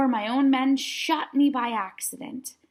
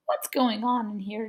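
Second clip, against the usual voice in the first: ordinary delivery, nothing marked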